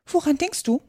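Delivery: high, reproachful question; stern mouse. A narrator's reading. Mouse questions daydreaming Alice, sternly. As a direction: demand an answer in a high mouse voice. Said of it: "sagte die Maus strenge zu Alice".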